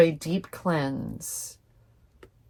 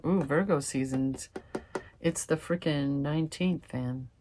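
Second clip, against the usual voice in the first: ordinary talk, nothing marked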